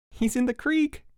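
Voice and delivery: high, gleeful announcement; crowing. A narrator's reading. Mike hears Harold splash, crowing. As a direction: crow it in a high pitch. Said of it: "crowed Mike".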